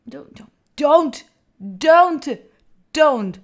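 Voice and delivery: loud, slow repeated pleas; pleading protest. Real, not acted. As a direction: call out each word, urging them to stop. Begs donor to stop.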